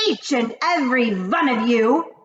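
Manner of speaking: loud high witch command; thundering witch. Acted. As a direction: command the hall. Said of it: "thundered the Grand High Witch".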